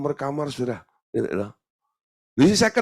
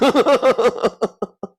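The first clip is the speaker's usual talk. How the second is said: high, loud laughing fit; real laughter; 11 semitones higher, 5 dB louder than usual. Real, not acted. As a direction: crack up at your own story. Laughs at own story.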